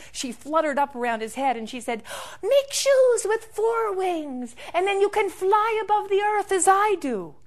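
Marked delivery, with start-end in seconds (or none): 2.4-7.3 flutter with excitement; excited bird voice. Acted. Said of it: "she got really excited".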